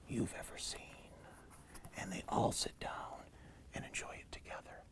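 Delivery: very soft, whispery storyteller's close; hushed ending. A narrator's reading. Animals share the pie at story's end, hushed. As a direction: wind the tale down to a whisper.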